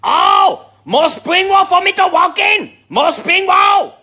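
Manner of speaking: the Chinese restaurant waitress greeting Walken, high and loud; imitating the waitress. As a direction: belt it out high like the excited lunch lady. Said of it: "She'd come out, she'd go".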